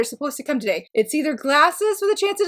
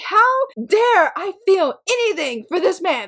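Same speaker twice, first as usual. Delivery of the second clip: mock outrage, a little higher; retold indignation; 5 semitones higher than usual. Reader recounting her anger at a character, mildly indignant. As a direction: protest it with mild indignant force. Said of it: "I was so angry".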